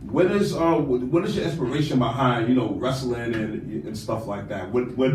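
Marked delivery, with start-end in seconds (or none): none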